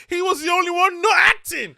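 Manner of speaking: high, loud laughing delivery. Real, not acted. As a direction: blurt it out through laughter. Laughing at his own joke.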